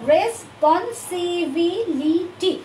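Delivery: Responsibility slowly, each syllable set apart; broken into syllables. Shown as a demonstration. Teacher repeating so students can memorise, responsibility syllable by syllable. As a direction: chop responsibility into long separate beats. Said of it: "এভাবে ভেঙে ভেঙে শিখলে".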